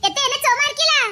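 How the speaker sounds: ordinary delivery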